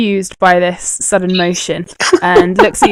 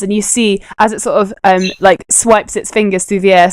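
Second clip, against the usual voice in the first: ordinary delivery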